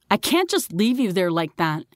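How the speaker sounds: ordinary delivery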